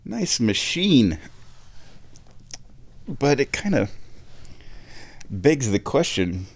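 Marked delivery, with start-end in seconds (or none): 0.0-1.2 impressed. Retold or imitated. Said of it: "And it's like".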